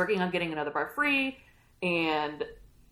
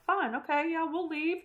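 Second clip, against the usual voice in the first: mock compliant; 10 semitones higher than usual. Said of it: "Etta and Ken are like".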